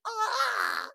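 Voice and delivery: high, comic squawking moan; imitated moan. Host recreating her own moan soundbite, high mock moan. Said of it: "played that moan soundbite, like".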